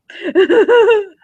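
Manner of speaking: high giggle before a coy hi; flirty giggle. Acted.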